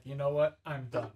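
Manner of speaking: loud, fed-up quit. Retold or imitated. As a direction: announce you're done and walk off. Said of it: "And then Reggie's like".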